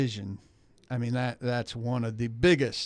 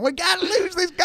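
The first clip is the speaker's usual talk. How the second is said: put-on Southern accent. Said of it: "I should be in the Southern accent".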